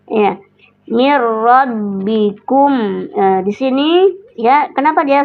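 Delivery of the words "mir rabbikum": "Mir rabbikum" drawn out slowly with a long held "mir"; slow tajwid demo. Shown as a demonstration. Teacher slowly demonstrating recitation to a student, drawn out. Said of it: "tafkhim, ya, tebal".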